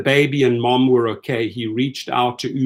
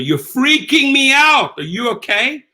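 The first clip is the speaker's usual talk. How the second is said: freaked-out driver; 11 semitones higher, 4 dB louder than usual. Retold or imitated. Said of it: "And I told her".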